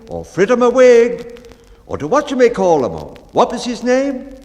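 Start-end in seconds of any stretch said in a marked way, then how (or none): 0.2-1.5 much higher and much louder shout; loud cry. A narrator's reading. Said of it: "or to any loud cry". 2.1-2.9 much higher, much louder call; loud cry. A narrator's reading. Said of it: "or to any loud cry". 3.3-4.3 much higher, louder calling question; loud cry. A narrator's reading. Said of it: "or to any loud cry".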